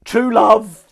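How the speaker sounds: high, loud mock-grand declaration. Declaring true love, high and loud. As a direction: announce it like a soppy proclamation.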